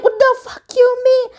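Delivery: very high incredulous squawk; frantic, high-pitched disbelief; outraged disbelief. Retold or imitated. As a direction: snap back high and indignant. Reacting to friend who can't come.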